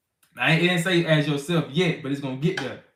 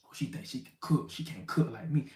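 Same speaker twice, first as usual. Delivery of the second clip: jealous scoff; 3 semitones lower, 11 dB quieter than usual. Retold or imitated. Jealous husband mocking wife.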